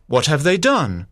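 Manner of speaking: ordinary delivery, nothing marked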